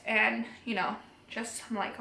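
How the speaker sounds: usual speaking voice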